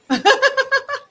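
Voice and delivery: high burst of laughter at the silly phrase; amused high-pitched laugh. Real, not acted. Laughs at own example.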